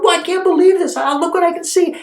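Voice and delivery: high, excited exclaiming; thrilled disbelief. Acted. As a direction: marvel at your own luck. Healed man marvels aloud.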